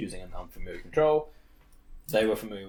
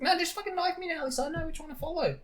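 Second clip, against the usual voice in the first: very high put-on child voice; squeaky mock gamer child, insisting; twelve-year-old kid. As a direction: imitate a whiny kid giving orders. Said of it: "this little fucking twelve-year-old".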